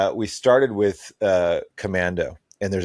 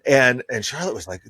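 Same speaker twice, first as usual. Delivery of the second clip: ordinary talk, nothing marked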